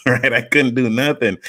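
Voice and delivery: normal talking voice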